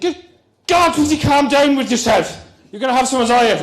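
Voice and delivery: high, loud Irish exclamation; Irish accent. Acted. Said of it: "say it in an Irish voice".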